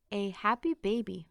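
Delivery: plain reading voice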